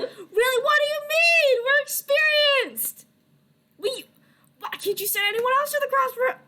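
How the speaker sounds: high, loud incredulous whine; put-upon, squawking outrage; indignant protest. Acted. Acted protest on the phone.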